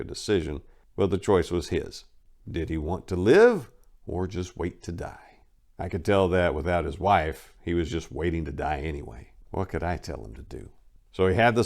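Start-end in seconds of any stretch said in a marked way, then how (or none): none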